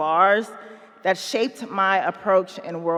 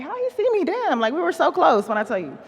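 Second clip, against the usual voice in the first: incredulous; 8 semitones higher than usual. Retold or imitated. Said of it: "And I was like".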